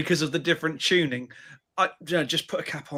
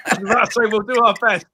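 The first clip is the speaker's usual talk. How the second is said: talking through laughter, a bit higher; laughing; 4 semitones higher, 5 dB louder than usual. Real, not acted. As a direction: laugh along while making the promise. Joking reply amid laughter.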